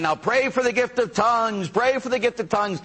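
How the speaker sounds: lightly voiced priest urging people to pray. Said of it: "he's saying".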